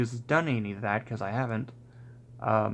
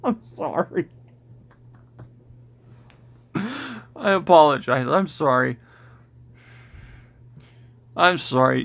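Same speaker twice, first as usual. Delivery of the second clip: giggly sorry, louder; laughing apology; 3 semitones higher, 6 dB louder than usual. Real, not acted. Apologizing while laughing at comment.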